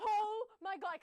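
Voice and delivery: slow, loud and high oh my God; slow, overwhelmed exclamation. Real, not acted. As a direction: let it spill out like it's still overwhelming. Reliving overwhelming concert moment.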